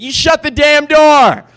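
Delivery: high, loud, drawn-out furious command; angry slow shout. Retold or imitated. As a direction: yell at him to shut it. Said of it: "And she said".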